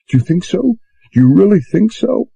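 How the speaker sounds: put-on girl asking the bosun, suddenly hopeful. A narrator's reading. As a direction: grab at the hope. Susan hopeful.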